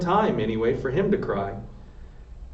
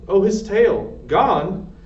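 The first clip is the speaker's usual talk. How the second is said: shocked; 7 semitones higher, 5 dB louder, much slower than usual. A narrator's reading. Mouse discovers his tail gone.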